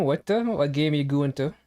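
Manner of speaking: normal talking voice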